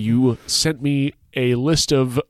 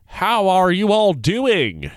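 slow, higher, hammed-up mock American accent; 8 semitones higher, slower than usual. Mocking an Australian trying an American accent, hammed-up. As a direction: ham up a clumsy American accent, drawn out. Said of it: "in, like, an American English accent".

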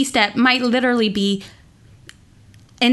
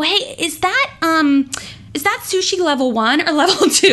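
higher, laughing mock-naive asking; mock silly question; 5 semitones higher than usual. Pretending to ask a waiter about sushi level, laughing mock. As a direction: ham up a clueless diner.

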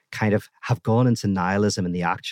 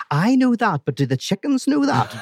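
pitched way up, a touch of hammed-up panic; mock panic; 11 semitones higher, 3 dB louder than usual. Patient pleads with analyst about the chickens, mock panic higher. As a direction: play the patient's comic dread, higher.

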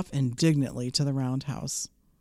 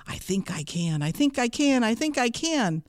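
rhythmic refrain climbing higher; hopeful little engine; 6 semitones higher than usual. A narrator's reading. Little engine deciding to help the toys, hopeful. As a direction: build from tentative to determined.

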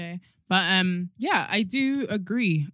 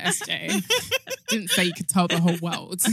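ordinary delivery

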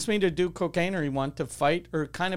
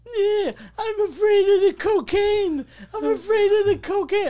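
sing-song mock whine, much higher; mocking whiny guy; 6 dB louder than usual. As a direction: squeal it like a taunting coward. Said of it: "then he's like".

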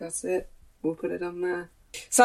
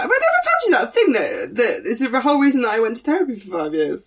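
laughing mock confession; 6 semitones higher, 5 dB louder than usual. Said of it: "we do this a lot where we're like".

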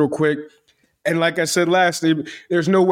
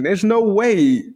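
drawn-out, higher-pitched incredulity; mock disbelief; 5 semitones higher, much slower than usual. Listener doubting his homework story, slow mock disbelief. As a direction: stretch it out, refusing to believe.

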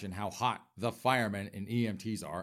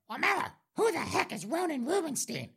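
much higher, put-on quizzical voice; puzzled viewer. Host voicing viewers who ask who Ronan is, in a high mocking voice. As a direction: squeak it out like a baffled fan.

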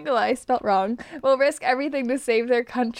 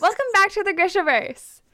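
excited announcer; 9 semitones higher, 6 dB louder than usual. Acted. Announces the book recap.

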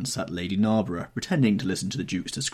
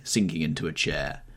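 usual speaking voice

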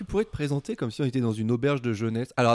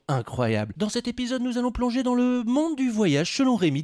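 radio announcer; 5 semitones higher, 3 dB louder than usual. Acted. Reads show intro over music.

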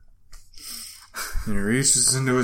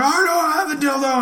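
high put-on denial; thief denying. Shoplifter denying to the clerk, high mock voice. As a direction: toss the denial back in a caricature voice.

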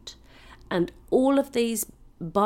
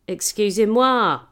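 mock French complaint; 8 dB louder, much slower than usual. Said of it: "and the wine was cool".

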